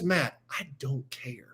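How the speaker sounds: slow, near-whispered imitation of the coach; quiet, deliberate retold brush-off; unbothered coach. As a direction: drop to a hushed, slow dismissal. Coach's deadpan answer, acted out.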